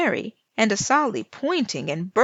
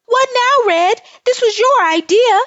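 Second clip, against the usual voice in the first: much higher, very loud frightened shout; panicked yell; 11 dB louder than usual. Acted. Cousins yelling at Lil Red as the wolf closes in, very loud. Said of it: "and yelled".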